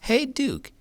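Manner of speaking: usual speaking voice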